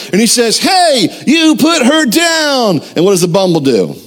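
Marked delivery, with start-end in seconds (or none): 0.6-2.8 high-pitched, drawn-out heroic shout; Rudolph. Retold or imitated. Hero confronting the Bumble, mock heroic shout. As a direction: play a young cartoon hero calling out a command. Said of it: "Rudolph jumps in to be the hero".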